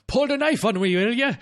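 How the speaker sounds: high Scottish policeman voice; Scottish accent. Acted. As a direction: play a gruff Scottish constable. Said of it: "a Scottish constable".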